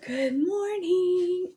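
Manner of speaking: slow mock morning greeting; drawn-out greeting. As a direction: stretch it out lazily.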